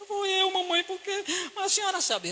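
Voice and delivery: put-on high, timid little boy; small boy answering his mother, high and meek; child's voice. Little boy answering his mother, high timid voice.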